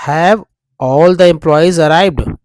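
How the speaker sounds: slow, loud, word by word: "Have all the employees arrived?"; each word read out slowly; slow dictation. Shown as a demonstration. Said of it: "एक बार मैं बताऊंगा". (धीमे और साफ़ पढ़ा हुआ प्रश्न)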